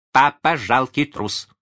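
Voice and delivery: high, loud, drawn-out taunt; taunting child. A narrator's reading. Small son calling his father coward, taunting. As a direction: jeer slowly in a child's high voice.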